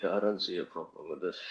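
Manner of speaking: signal cutting out, voice very faint; radio breaking up. Real, not acted. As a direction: voice fading in and out on a bad line. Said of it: "your voice is coming and going".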